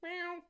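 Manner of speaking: high, soft imitated meow. Meowing to call his cat Mia, high and soft.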